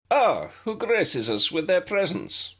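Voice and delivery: a teacher calling out playfully from inside; theatrical host greeting guests; musical voice. A narrator's reading. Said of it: "came a musical voice from within".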